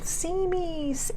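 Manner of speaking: a high, soft little voice, slow; tiny nugget calling out. Pretend. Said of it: "screaming at you going".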